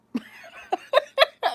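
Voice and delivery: loud, high burst of laughter. Real, not acted. Mother retelling son's remark, laughing loudly.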